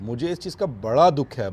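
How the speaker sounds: ordinary talk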